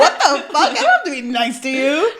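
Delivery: loud, indignant yell with laughter; hammed-up fury, half laughing; mock outrage. Acting out outraged retort.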